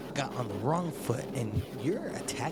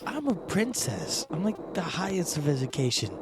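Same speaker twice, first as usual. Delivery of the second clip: higher haughty princess voice; 7 semitones higher, 8 dB louder than usual. Acted. Princess grilling the adventurer about his magic, higher haughty voice. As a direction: play the snobbish princess looking down on him.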